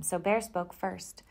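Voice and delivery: usual speaking voice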